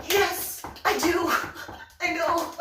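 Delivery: high, loud, bouncing answers; eager excited babbling. Retold or imitated. Private answering the general's rebuke, high and excited. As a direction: gush that meeting him is so cool. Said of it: "do you know you're in uniform?".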